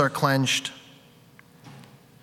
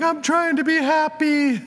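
much higher, squeaky voice while sobbing; tearful squeak; 13 semitones higher than usual. Retold or imitated. Retelling her sobbing by the river, high and tearful. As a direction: cry it out in a tiny high voice. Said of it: "And I'm like".

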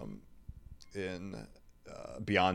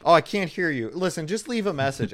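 higher, louder pretend-answer voice; fake voicemail greeting; 8 semitones higher, 7 dB louder than usual. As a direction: play it like a trick recording. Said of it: "the fake one where".

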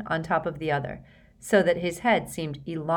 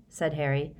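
plain reading voice

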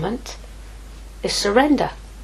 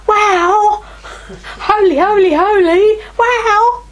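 loud, high cry of wonder, arms thrown wide; awed exclamation; 10 semitones higher, 5 dB louder, slower than usual. Retold or imitated. Elders before the throne crying out in awe, loud and high. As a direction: shout it out in amazement, higher and louder.

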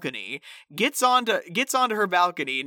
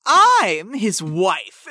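slow, louder, emphatic claim; mock Emily; 5 dB louder, much slower than usual. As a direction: spell out each word, triumphant. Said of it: "she goes".

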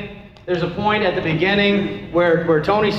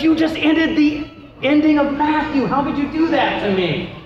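higher-pitched, playful indignation; mock outraged complaint; 8 semitones higher than usual. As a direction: protest as if someone spoiled the ending. Acting out an upset reader's complaint.

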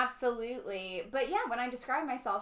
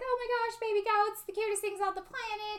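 put-on much higher, squealing over baby goats; gushing child; 12 semitones higher than usual. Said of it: "And we were just like".

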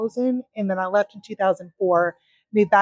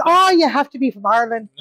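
terrible put-on Irish accent, pitched up; fake Irish accent; 4 semitones higher, 4 dB louder than usual. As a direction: do a bad, excitable Irish accent. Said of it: "forgive my, my fake Irish accent".

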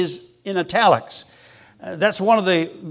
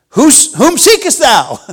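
commanding call; 7 semitones higher, 6 dB louder than usual. Acted. Voicing Jesus confronting soldiers.

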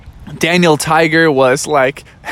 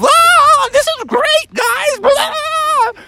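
much higher, delighted squealing; high gleeful Daniel; much slower than usual. Acted. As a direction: whoop while tumbling down the wave. Daniel tumbling happily down wave.

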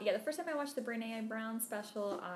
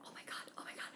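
frantic hushed fangirl whisper; soft, breathless starstruck aside; 13 dB quieter, faster than usual. Acted. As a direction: whisper it in acted awe. Starstruck aside to trainer, retold.

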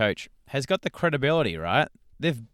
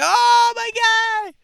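very high, drawn-out mock shout; gushing fan squeal; 10 dB louder, much slower than usual. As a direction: shriek it like an overexcited fan. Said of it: "tons of people sharing it".

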